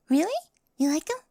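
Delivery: high, bright, loud really; excited little gasp of a question; surprised delight. Acted. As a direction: perk up, thrilled he likes them. Delighted that he likes them.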